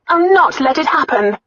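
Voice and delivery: high, loud, defiant vow; angry resolve. A narrator's reading. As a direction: vow it angrily, pitched high. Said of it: "never seen her niece look so angry".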